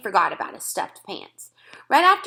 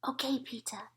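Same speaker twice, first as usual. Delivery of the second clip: tiny higher whisper, barely there; a small boy whispering back; toddler whisper; 4 semitones higher, 12 dB quieter than usual. A narrator's reading. Fudge answers his brother in a whisper. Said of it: "he said".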